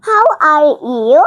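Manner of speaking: very slow, each word drawn out; slow demo. Shown as a demonstration. Child teacher showing classmates, slow careful How are you. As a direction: spell it out slowly for learners. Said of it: "আমি তোমাদের শুনিয়ে দিচ্ছি".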